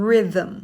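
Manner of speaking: "rhythm" as RI-thum, short i and weak ending; correct pronunciation. Shown as a demonstration. Teacher shows how it really sounds, correct pronunciation of "rhythm". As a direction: say "rhythm" crisply in two syllables. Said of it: "จริงๆมันออกเสียงว่า".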